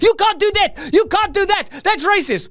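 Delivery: very high-pitched put-on objector; hammed-up falsetto indignation; mock outrage. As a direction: squeal it like a scandalized complainer.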